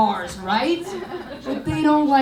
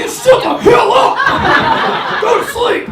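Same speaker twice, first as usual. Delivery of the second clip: much higher, louder shut the hell up; mock angry yell; 11 semitones higher, 9 dB louder than usual. Comic answering her date's insult, mock angry yell. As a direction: yell it back at him. Acted quote snapping at date.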